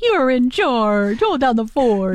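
slowed, mildly firm delivery; slow serious order. Retold or imitated. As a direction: hand the dog its duty slowly. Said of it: "Maybe they say it like that".

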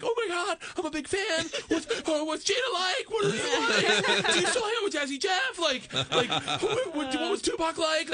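high, breathless, laughing shouts of a starstruck fan; squealing starstruck fan, high and laughing; gushing fanboy. Retold or imitated. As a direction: gush at your hero like a giddy kid. Fanboy gushing at [name].

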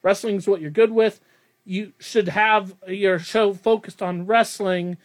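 ordinary talk, nothing marked

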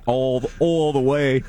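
stretched Philly vowels on "all the way"; slow, drawn-out Philly accent. Shown as a demonstration. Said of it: "I can't do it all the way".

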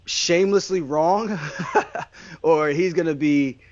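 chuckling slowly through the words; laughing. Real, not acted. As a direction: let a laugh break into it. Laughs at own joke.

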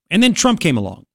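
usual speaking voice

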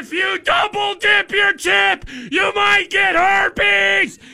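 high, slow, shouted dramatic warning; horror trailer voice. Pretend.

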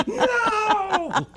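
very high laughing mock groan of no; passengers groaning no. Passengers reacting to the crying kid, high laughing no. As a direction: groan on behalf of the whole plane. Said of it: "Everyone on the plane went".

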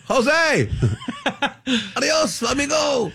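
laughing mock shout. Mocking migrants leaving by boat.

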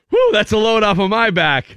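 high, hammed-up sigh of relief; mock relief. Bully voicing relief to his old victim, high and hammy. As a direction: play the bully's fake relief. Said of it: "mock relieved former bully".

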